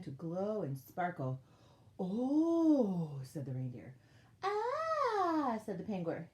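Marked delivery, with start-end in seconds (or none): 2.0-3.2 long, loud swelling ooh; awed reindeer. A narrator's reading. Reindeer seeing the workshop sparkle, loud awe. 4.4-5.6 very high, long, loud aah; awed penguin. A narrator's reading. Penguin seeing the workshop sparkle, high awe.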